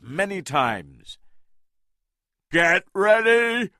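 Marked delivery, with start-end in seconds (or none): 2.5-3.7 loud, high call to his men; shouted. A narrator's reading. Engelhorn warning his men, a loud shout. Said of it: "Engelhorn shouted".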